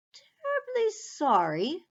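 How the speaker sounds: murmuring mouse. Acted. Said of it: "murmured the mouse".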